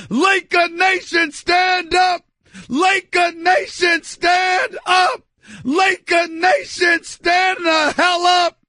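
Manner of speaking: chanting rally cry, drawn out; slow chant to rouse Laker fans; rallying call. Real, not acted. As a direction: rouse the crowd like a hype man.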